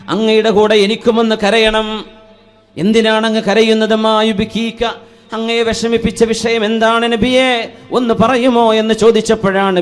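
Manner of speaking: loud, high-pitched sermon delivery; impassioned preaching. Real, not acted. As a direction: preach it out in ringing, sustained phrases. Preaches a story of Bilal.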